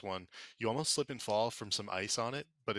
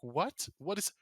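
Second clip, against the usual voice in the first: alarmed question; 7 semitones higher than usual. Acted. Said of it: "and she's like".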